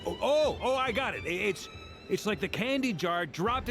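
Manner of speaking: excited. Acted. Guessing the candy, figures it out.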